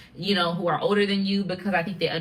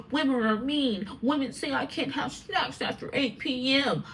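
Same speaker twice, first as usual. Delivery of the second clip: higher-pitched mock grievance; complaining grown son; 6 semitones higher, 4 dB quieter than usual. Grown man griping about his mom's rules, mock complaint. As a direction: mimic a man sulking like a little boy. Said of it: "Now he grows up saying".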